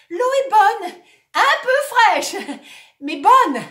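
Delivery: high, loud with a laugh; shouting. Acted. Actor in the water shouting cheerfully to the audience. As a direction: shout brave cheer through the cold. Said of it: "il crie".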